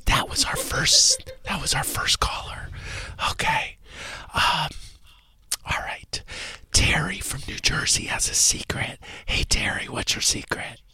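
hushed whispering host voice. Acted. Host whispering to his co-host on the Whisper Show. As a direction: keep the whole show in a whisper.

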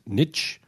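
niche said as "nitch", with short i; American pronunciation. Shown as a demonstration. Correcting the host for American listeners, niche pronounced "nitch". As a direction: say niche to rhyme with "pitch". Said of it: "I'd like to correct you for our, our, our American listeners".

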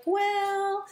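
high, drawn-out sheepish well; hesitant, embarrassed customer. Retold or imitated. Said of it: "they were like".

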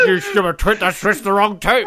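high, laughing mock scolding; quoted Bond scolding. Co-host quoting Bond berating Tiffany, laughing mock scolding. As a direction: imitate an exasperated telling-off through laughter. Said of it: "Doesn't he call her a stupid twit".

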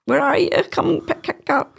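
high, panicky plea for someone to come; squeamish call for help. Retold or imitated. To her family, facing cat sick, a high flustered call. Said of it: "I'm like".

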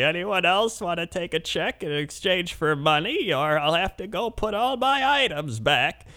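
high, slow, put-on old lady plea. Old lady asks the line to swap cash, high and slow. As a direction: beg the whole line like a sweet old lady. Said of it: "She's like".

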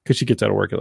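ordinary delivery

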